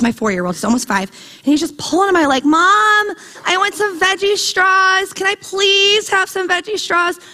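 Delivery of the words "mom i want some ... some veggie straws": put-on high, drawn-out little-kid whine; imitated whiny preschooler pleading; whining child. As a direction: beg mom like a nagging four-year-old. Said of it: "with the whining".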